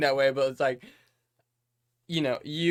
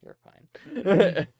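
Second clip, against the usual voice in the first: laughing; 6 semitones higher, 8 dB louder than usual. Real, not acted. Laughing after cough.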